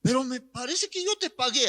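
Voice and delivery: lightly voiced drunk, high and soft; puzzled drunk insisting, lightly acted; drunk young man. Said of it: "El, este joven borracho".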